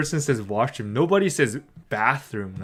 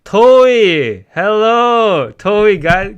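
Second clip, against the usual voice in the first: loud, high call to a friend; excited greeting; 6 semitones higher, 9 dB louder, much slower than usual. Real, not acted. Streamer loudly greeting her real-life friend Toei in chat, excited. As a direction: hail your friend joyfully.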